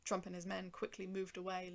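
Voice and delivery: plain reading voice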